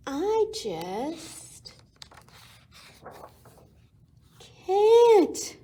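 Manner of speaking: high, loud frightened wail on can't; scared wail. Acted. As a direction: cry out in panic, clinging on. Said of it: "But Kevin was scared".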